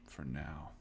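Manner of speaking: ominous whispered aside. Acted. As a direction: breathe it out softly. Ominous GM closing.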